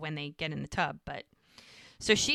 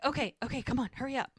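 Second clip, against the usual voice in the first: higher, mildly urgent hustle; retold urgency; 6 semitones higher, much faster than usual. Man rushing girlfriend from a fire, mildly urgent. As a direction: imitate hurrying someone out.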